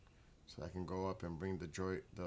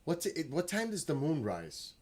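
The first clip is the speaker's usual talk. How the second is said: higher, louder question to a smart speaker; raised voice; 8 semitones higher, 5 dB louder than usual. Real, not acted. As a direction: call the question across the room. Asking Alexa aloud across room.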